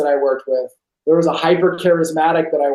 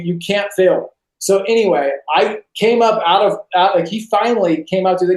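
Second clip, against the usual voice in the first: normal talking voice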